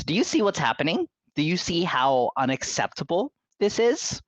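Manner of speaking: high, haughty society-matron reproof; Emily Gilmore. Acted. Committee head to woman upsetting children, high imperious disapproval. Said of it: "Let me do a more of Emily Gilmore voice".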